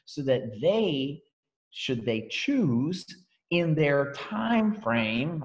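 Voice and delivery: very slow, spaced-out words; slow emphasis. Shown as a demonstration. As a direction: drag it out deliberately. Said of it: "I specifically slow down on certain words".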